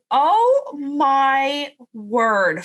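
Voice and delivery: very slow, drawn-out awe; awed gasp. Retold or imitated. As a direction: stretch it out, moved and stunned. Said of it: "I was like".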